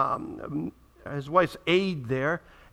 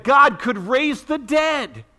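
slow, loud "God could raise the dead" with a high punch on "God"; emphatic proclamation; 7 semitones higher, 4 dB louder, much slower than usual. Real, not acted. Preacher to congregation, booming and slow. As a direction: proclaim it to the back row.

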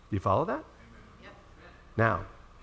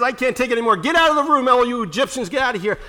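raised, commanding cry; Joseph's outburst; 7 semitones higher, 4 dB louder, faster than usual. Acted. Joseph to his Egyptians, loud and urgent. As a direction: drive the servants out of the room.

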